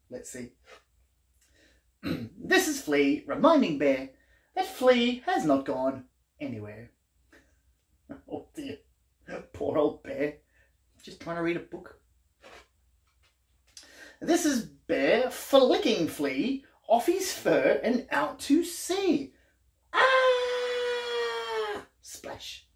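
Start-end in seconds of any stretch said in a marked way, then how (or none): 2.4-6.9 lively, sing-song picture-book reading; reading to children. A narrator's reading. 14.2-19.3 slow, drawn-out picture-book reading; storybook reading, slowed. A narrator's reading. 19.9-21.9 very high squeaky falling scream; cartoon scream. Bear flicked off, flea falls.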